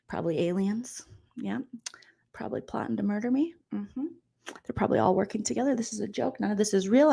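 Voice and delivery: soft, hushed musing to oneself; mock paranoid muttering. Recapper imitating suspicious housemate in thinking chair, paranoid mutter. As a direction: mutter conspiracy theories under your breath.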